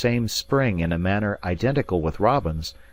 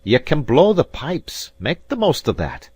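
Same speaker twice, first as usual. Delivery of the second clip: bitter concession; 8 semitones higher, 6 dB louder than usual. Acted. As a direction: grant it through injured pride. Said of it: "he cried".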